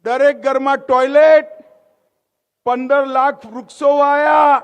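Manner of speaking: rally oratory. Real, not acted. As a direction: fire up the crowd for applause. Rally oratory at full force.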